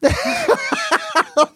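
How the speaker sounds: high burst of laughter; helpless high-pitched laughing; real laugh. Real, not acted. Laughing at own anecdote.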